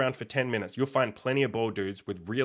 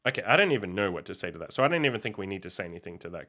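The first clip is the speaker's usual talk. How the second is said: usual speaking voice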